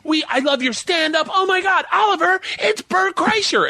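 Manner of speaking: high, breathless superfan gush; shrill excited fan voice over Oliver Stone. Retold or imitated. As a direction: squeal it like a starstruck handler. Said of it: "she's like".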